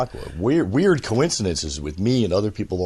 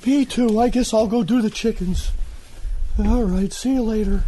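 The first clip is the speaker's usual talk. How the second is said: high put-on voice of the boat keeper; the boat keeper stalls, high and unhurried; lazy boat keeper; 11 semitones higher than usual. Ellis dodges his chicken chores, high character voice.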